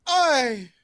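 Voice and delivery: high, loud dwarf shout; heckling dwarf. Acted. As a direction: call out like an indignant dwarf in the crowd. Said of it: "you see the dwarves just go".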